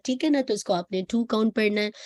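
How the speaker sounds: ordinary talk, nothing marked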